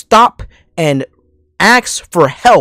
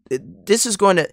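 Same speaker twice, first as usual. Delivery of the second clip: ordinary talk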